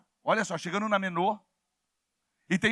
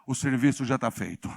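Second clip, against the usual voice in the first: deep, satisfied devil voice; the devil talking; 9 semitones lower, slower than usual. Retold or imitated. Devil peeking in the door, lower and pleased. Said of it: "ele fala".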